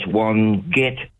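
Wongetty broken up, GET punched; stress on GET. Shown as a demonstration. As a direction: sound out Wongetty, leaning on "get". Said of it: "emphasise the get".

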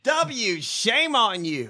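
high, drawn-out reproach; mock scolding. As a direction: wag a finger with your voice. Mock scolding of former president.

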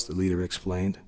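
ordinary delivery

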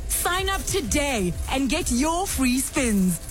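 ordinary delivery

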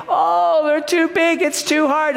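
high-pitched whiny imitation of grumblers; mock complaining. Spies back from the promised land, imitated complaining. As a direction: moan it in an exaggerated high voice. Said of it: "And they grumble and complain".

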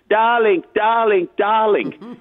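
high, drawn-out Hungarian diva "Darling"; Hungarian accent. Retold or imitated. Widower hears the dead film star calling, in a Hungarian accent. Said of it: "in her famous Hungarian accent".

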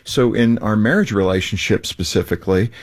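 normal talking voice

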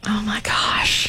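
slow, whispered shock; dismayed. Real, not acted. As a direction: draw it out in an appalled whisper. Dismayed reaction to the accident.

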